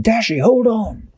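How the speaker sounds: high urgent call to a friend. A narrator's reading. Captain Barnacle to trapped Dashi, urgent call. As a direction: call out high, urging her to hang on.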